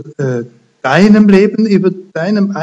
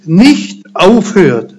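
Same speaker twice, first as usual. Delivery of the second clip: slow and personal; 2 semitones higher, slower than usual. Acted. Jesus promising Peter, slow and earnest. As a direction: speak it slowly, as a personal promise. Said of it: "Und jetzt wird's ganz persönlich".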